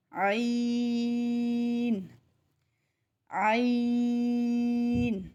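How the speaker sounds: عین long, pressed from the throat; throat-pressed ain. Shown as a demonstration. Tajweed teacher showing throaty عین. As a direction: squeeze the throat and stretch عین. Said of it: "حلق کے اوپر دباؤ".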